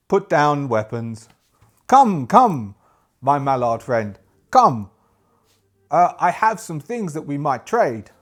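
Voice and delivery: loud, deliberate trader's voice; foreign-sounding merchant. Acted. Merchant inviting armed strangers to trade, loud accented trader voice. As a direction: beckon them over, punching each command. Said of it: "the merchant goes".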